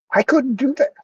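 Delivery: high, choked-up voice; getting emotional. Real, not acted. Refusing to let a man die, choked up. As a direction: let real emotion lift the voice. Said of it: "I'm getting emotional".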